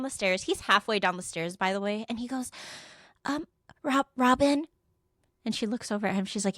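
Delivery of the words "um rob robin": higher, hesitant mock call; nervous stammer. Man calling Robin from the stairs, nervous stammer. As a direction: stumble timidly over the name.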